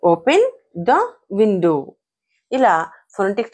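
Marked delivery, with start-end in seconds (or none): 0.0-1.8 "Open... the... window", each word separate; broken-up demonstration. Shown as a demonstration. Teacher showing pronunciation to learners, word by word. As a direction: pause after "Open" and after "the". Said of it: "వాటిని ఎలా పలకాలి".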